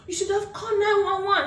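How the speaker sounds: high, half-laughing mock scold; mom scolding. Mom telling her kids what they should have done, high and scolding. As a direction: tell them off like an exasperated mother. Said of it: "And she said".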